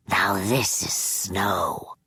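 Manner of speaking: slow low whispered exclamation; sinister old man. Acted. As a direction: breathe out the wonder, hushed. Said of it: "Charlie Manx cried".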